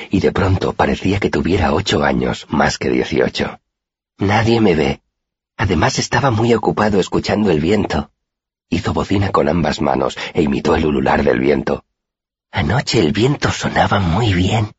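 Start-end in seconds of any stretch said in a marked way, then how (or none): 4.2-8.1 dreamy young girl, lightly voiced; lightly voiced shy girl Auri. A narrator's reading. Auri wistfully telling her friend of the moon. 12.5-14.7 slow, hushed secret-sharing; confiding tone. A narrator's reading. Auri confiding to her friend about the wind, slow and intimate. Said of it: "en tono confidencial".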